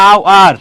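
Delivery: আওয়ার in two clear, stretched parts, opening on a vowel; pronunciation demo. Shown as a demonstration. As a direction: sound out আওয়ার for a learner, with no h at the start. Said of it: "উচ্চারণ করো তো". (আওয়ার ভেঙে, স্পষ্ট করে উচ্চারণ, শুরুতে হ ছাড়া)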